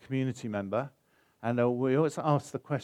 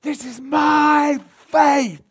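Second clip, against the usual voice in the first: a high, loud, drawn-out cry of faith; slow, ringing shout of triumph; joyful yell; 14 dB louder, much slower than usual. Retold or imitated. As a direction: shout it across the whole beach. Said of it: "she yells across the whole beach".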